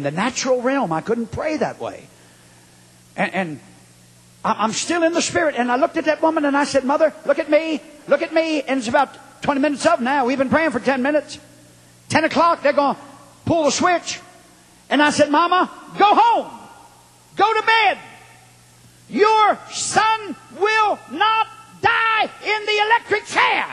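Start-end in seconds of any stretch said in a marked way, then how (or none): 4.4-15.3 high-pitched, urgent revival storytelling; impassioned preaching. Real, not acted. Preacher telling church about praying with a mother, impassioned. 15.3-23.7 high, drawn-out prophetic cry; shouted proclamation. Retold or imitated. Preacher assuring a condemned man's mother, shouted proclamation.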